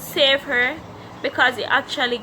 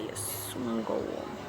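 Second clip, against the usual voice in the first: hushed reassurance; 12 semitones lower, 10 dB quieter, slower than usual. Retold or imitated. Quoting self at deathbed.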